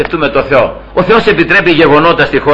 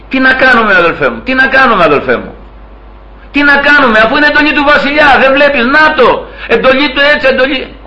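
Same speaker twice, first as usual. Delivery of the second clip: high-pitched, helpless protest; helpless pleading; 7 semitones higher than usual. Acted. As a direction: plead it high, as if there's no choice. Acts out parents' helpless excuse. (απελπισμένη διαμαρτυρία με ψηλή φωνή)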